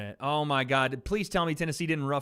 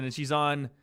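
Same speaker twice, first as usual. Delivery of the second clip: normal talking voice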